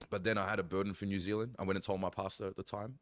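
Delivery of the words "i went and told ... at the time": ordinary delivery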